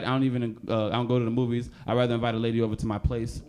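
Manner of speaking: ordinary talk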